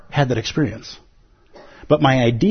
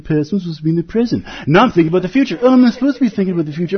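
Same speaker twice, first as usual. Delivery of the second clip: racing thoughts; 4 semitones higher, much faster than usual. Retold or imitated. Racing meditation thoughts acted.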